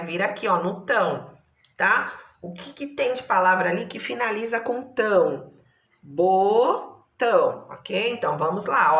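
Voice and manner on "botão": Botão slowly split as bo, tão; syllables separated. Shown as a demonstration. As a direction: stretch out bo, pause, then tão in Botão. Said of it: "olha lá, separadas".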